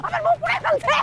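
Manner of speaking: high-pitched wail of pain. Acted. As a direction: cry out as if scalded. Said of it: "খবরদার চিল্লাবি না".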